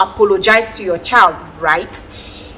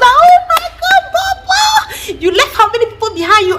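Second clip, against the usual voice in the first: very high, loud, gushing praise; excited praise; 4 dB louder than usual. Retold or imitated. Mother praising her son's report card, very high and loud. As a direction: gush over him like he's a genius. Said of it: "I was like".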